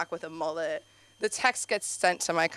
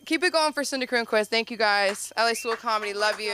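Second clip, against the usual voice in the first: host outro; 2 semitones higher, 4 dB louder than usual. Real, not acted. Host wraps up the set.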